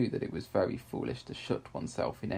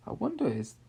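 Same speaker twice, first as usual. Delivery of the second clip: curious wondering; 9 semitones higher than usual. A narrator's reading. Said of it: "she thought".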